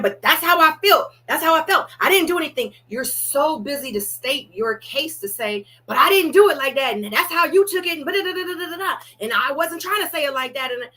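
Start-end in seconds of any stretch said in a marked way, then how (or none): 0.0-2.7 higher and louder, mocking the self-justifier; mock defensive whine. 5.9-10.7 higher and louder, mocking the self-justifier; mock defensive whine.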